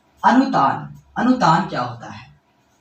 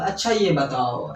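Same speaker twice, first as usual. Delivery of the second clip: slow, lower अच्छा ये बताओ, stretched out before a question; questioning lead-in; 4 semitones lower, 3 dB quieter, slower than usual. Shown as a demonstration. Teacher showing the questioning use of the word, inquiring. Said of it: "एक होता है सवाल करते हैं".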